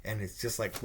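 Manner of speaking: normal talking voice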